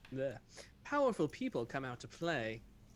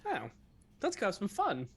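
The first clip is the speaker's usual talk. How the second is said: ordinary delivery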